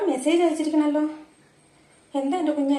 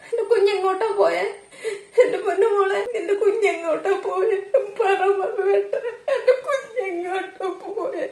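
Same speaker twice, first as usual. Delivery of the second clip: sobbing lament; 2 semitones higher than usual. Acted. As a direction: sob out the questions, then break into a full wail at the end. Mother hears her daughter is dead.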